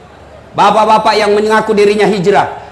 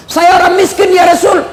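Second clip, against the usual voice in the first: protesting man; 6 semitones higher than usual. Acted. Man protesting he's poor.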